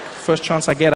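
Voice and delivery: ordinary talk, nothing marked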